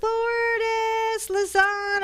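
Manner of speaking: high, drawn-out call of the name; sing-song name. Acted. Host to guest, drawn-out sing-song name. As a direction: stretch the name out up high like a greeting.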